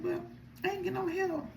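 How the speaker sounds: teary whine. Retold or imitated. Crying to cousin on phone.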